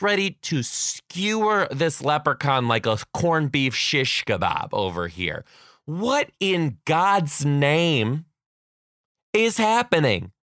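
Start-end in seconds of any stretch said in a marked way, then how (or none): 5.8-10.3 slow, rising incredulous mock alarm; drawn-out teacher disbelief. Teacher catching kids at outlet.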